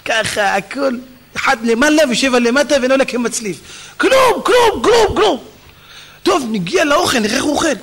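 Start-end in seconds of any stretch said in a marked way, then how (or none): 4.0-5.4 high, rhythmic glug noises; comic water-pouring sound effect; imitated glugging. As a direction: pump out high-pitched repeated glugs. Imitates noise during ritual hand washing.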